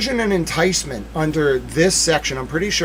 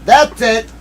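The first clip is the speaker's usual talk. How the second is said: higher and louder; triumphant call; 8 semitones higher, 7 dB louder than usual. Real, not acted. Streamer to chat, triumphant. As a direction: call it out victorious. First take.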